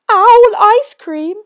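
high, loud hooting alarm; panicked owl. Acted. Owl flees from the mouse, hooting in alarm. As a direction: hoot in fright and flee.

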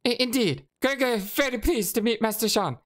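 high, stammering rat shopkeeper. Acted. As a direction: squeak it out like a nervous rat. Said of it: "rat voice".

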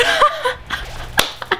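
high hammed-up laughter; giddy acted laugh. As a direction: let the giggle squeal off high. Acted bro reacting to ice-cream invite.

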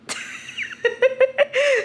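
high, giddy laugh; high burst of laughter. Real, not acted. Telling viewers a guy wants to hang, giddy high laugh. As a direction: laugh like it's ridiculous.

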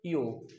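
यो said softly alone; vowel demo. Shown as a demonstration. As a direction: model the sound यो. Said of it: "दो लगे तो".

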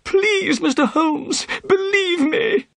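very high, pleading wail; frightened man's shrieked plea; desperate cry. A narrator's reading. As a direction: beg tearfully to be believed. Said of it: "Thaddeus cried".

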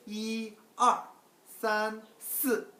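이, 알, 산, 스 slow, high and loud, each separate; exact pronunciation. Shown as a demonstration. Teacher models counting to four, exact pronunciation. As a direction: hold 이 and 산 level, drop 알 and 스 sharply. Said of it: "정확한 발음은 어떻게 하냐?".

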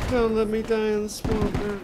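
high, loud, hammed-up begging; desperate plea. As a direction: beg for your life dramatically. Downed player pleading for revive.

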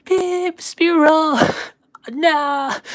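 very high squeaky cartoon creature voice; imitating Pikachu. Streamer voicing Pikachu's reply, very high and squeaky. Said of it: "then he's like".

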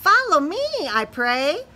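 tiny glowworm calling; squeaky glowworm. Acted. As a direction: squeak the invitation tinily. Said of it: "squeaked the glowworm".

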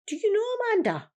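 high, loud, far-off imitated voice; imitating her husband's eager question. Husband asking eagerly about wife.

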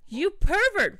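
high, loud accusation; acted outrage. Yuna accusing him of peeking, high loud outrage. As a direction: fling it out high and loud, scandalized.

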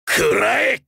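high, loud attack shout; sharp shouted attack call; battle cry. Acted. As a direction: hurl it at the enemy as you strike.

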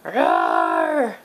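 put-on low playful roar for a child; dinosaur roar. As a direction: growl like a toy T-Rex. Parent voices the toy dinosaur.

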